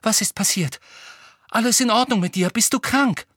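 high, urgent worried questions; very anxious. A narrator's reading. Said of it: "wirkte sehr verängstigt".

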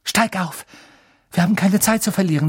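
high, fast urging; urgent. Acted. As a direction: hurry him up. Urging escape. (drängend)

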